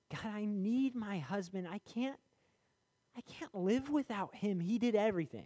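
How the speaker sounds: high, pleading widow's cry, imitated; imitating grandma's prayer. Grandma at the grave begging God for her husband, high and pleading. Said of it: "I was telling God".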